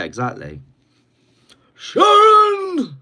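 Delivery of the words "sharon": high, drawn-out holler; shouted call. Acted. Calling out like [name] to his wife, shouted. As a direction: yell the name out long and high.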